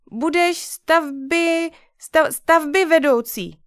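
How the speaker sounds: put-on high little girl, slow and deliberate; slowly, carefully. Sister offers the job, slow and careful. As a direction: lay each syllable down with care. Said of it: "řekla pomalu a dala si na tom slovu záležet".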